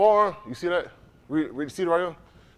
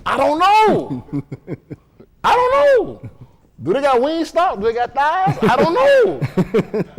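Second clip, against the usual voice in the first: high, drawn-out "I don't know"; comic worry; 7 semitones higher, 4 dB louder, slower than usual. Pretend. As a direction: stretch each line like a worried joke. Comic worry about heaven.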